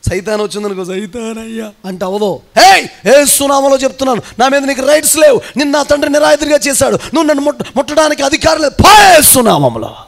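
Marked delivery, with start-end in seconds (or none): 0.8-1.7 softer, helpless imitation of begging; mock whining plea. Shown as a demonstration. Preacher mimicking a feeble prayer when Satan comes, mock whining. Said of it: "అంతేగాని ఏడుస్తా". 2.6-9.9 loud, high-pitched shout ending in a stretched command; commanding rebuke. Shown as a demonstration. Preacher showing how to rebuke Satan, forceful shouted command.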